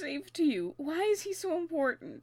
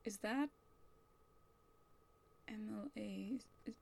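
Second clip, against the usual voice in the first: soft hushed surprise; quiet stunned realization; 7 semitones lower, 20 dB quieter than usual. Acted. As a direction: whisper the discovery. Discovering file in secret.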